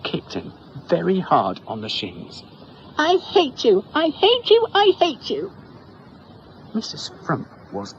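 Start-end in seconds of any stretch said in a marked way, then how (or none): 3.0-5.5 high, loud shrill tantrum; girl yelling at the doctor; childish tantrum. Acted. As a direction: scream it like a furious child.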